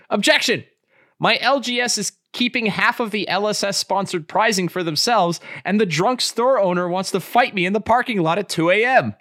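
high, indignant pretend-lawyer plea; mock courtroom objection. Player complains to the judge about a game store, mock indignation. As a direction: plead the complaint high and hammed-up.

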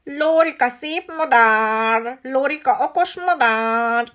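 slow, loud preening squawk; boastful parrot. A narrator's reading. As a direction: show off like a vain parrot. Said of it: "hozzálátott magát dicsérni".

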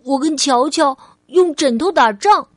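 put-on eager childlike questioning; little girl Ali, testing. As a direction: play a small child posing a what-if. Child character asks mother.

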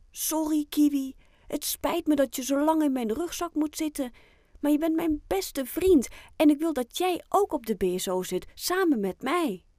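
put-on high, earnest child's voice; apologetic little Oscar. Boy apologising to his pet in the rucksack, earnest and high.

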